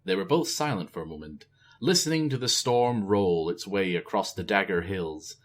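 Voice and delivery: plain reading voice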